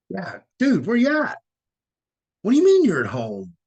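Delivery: high, loud mock yelling into the phone; angry phone yelling. On a club payphone, yelling at Jeff who went home, loud and high. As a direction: yell your disbelief down the line.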